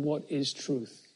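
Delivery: soft, slow, cynical question; Pilate's quiet, cynical dismissal of Jesus; quiet cynicism. Retold or imitated. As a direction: say it without wanting an answer. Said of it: "with a certain cynicism".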